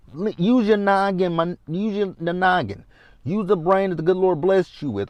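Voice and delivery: high, exasperated scold to use her noggin. Real, not acted. Vlogger scolding Brooke about blocking, high exasperated. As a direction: pitch up and plead for common sense.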